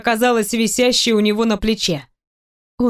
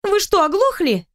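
indignant; 9 semitones higher than usual. A narrator's reading. Heroine indignant at boss.

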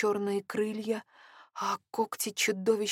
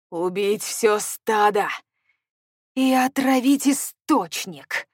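loud, slow furious growl; 2 semitones higher, 10 dB louder than usual. A narrator's reading. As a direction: growl it out in rage. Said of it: "рычал Хоук".

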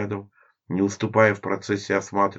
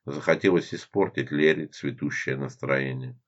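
plain reading voice

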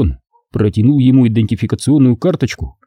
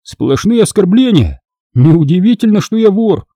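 higher-pitched, exclaiming thief's voice; indignant young thief; 8 semitones higher, 3 dB louder than usual. A narrator's reading. Thief complaining of constant insults, indignant. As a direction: voice an aggrieved rogue, pitched up.

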